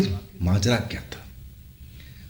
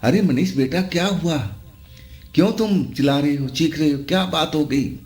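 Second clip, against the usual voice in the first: raised, higher-pitched anxious calling; mild narrated shouting; 7 semitones higher than usual. A narrator's reading. Parents calling to their son through a shut door, mildly shouted. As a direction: call out urgently, without a full shout. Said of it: "चिल्ला के बोलने लगे".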